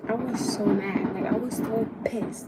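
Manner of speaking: angry venting about the ex; real anger. Real, not acted. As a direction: let the anger out, still stewing. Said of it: "I'm still mad at that shit".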